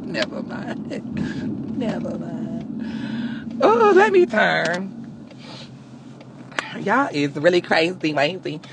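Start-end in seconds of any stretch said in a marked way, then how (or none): 0.0-3.6 slow, soft, breathy retreat; sheepish whispered take-back; hushed never mind. Real, not acted. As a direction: drop to almost nothing and let it trail. Backs off, embarrassed laughing.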